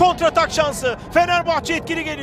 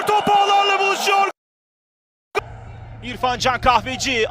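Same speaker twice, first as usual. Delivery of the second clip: ordinary talk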